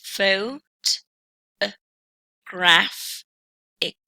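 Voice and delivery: photographic broken up, "Phot-, uh, graph-ic", with gaps; syllable by syllable. Shown as a demonstration. Teacher showing four different syllables of photographic, said piece by piece. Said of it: "we have four different syllables".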